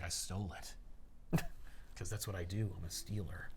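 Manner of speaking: low, soft, matter-of-fact admission; offhand confession. Retold or imitated. Thief owns up to stealing lipstick, offhand. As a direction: drop low and quiet, owning up casually. Voicing an unrepentant thief's answer.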